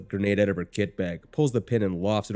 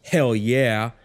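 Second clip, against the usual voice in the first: loud, higher victory yell; screamed cheer; 4 semitones higher, 6 dB louder than usual. A narrator's reading. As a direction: shout it in triumph. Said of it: "somebody screams to my right".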